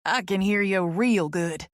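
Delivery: slow, easy Kentucky drawl. Acted. Lawyer Charlie reassuring Tess on the phone, slow Kentucky drawl. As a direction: stretch it out like a small-town Kentucky lawyer. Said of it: "his thick Kentucky drawl".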